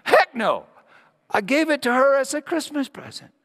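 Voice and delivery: high, loud folksy "Heck no"; hammed-up cheerful milkman; aw-shucks emphatic milkman; wholesome milkman. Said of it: "That's the way milkmen talk".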